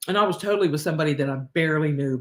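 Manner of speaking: ordinary talk, nothing marked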